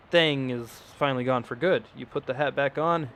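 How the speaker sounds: ordinary talk, nothing marked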